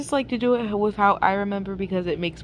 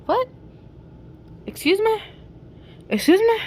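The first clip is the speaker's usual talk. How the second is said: high, rising mock disbelief; flabbergasted; 5 dB louder than usual. Reacting to Hannibal being called sneaky, acted flabbergasted. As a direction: squeal the questions in put-on shock. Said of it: "like, flabbergasted".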